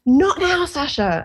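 sharp, loud, high "Not now"; snapping shout. Retold or imitated. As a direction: bark it at the child, irritated. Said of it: "and shouted at her".

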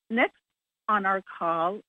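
usual speaking voice

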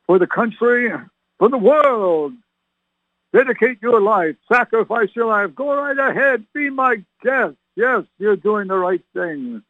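high, sarcastic rallying cry; mock exhortation. Preacher mocks those wanting to fight, high sarcastic urging. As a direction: egg them on with false approval. Mock cheer for going to war.

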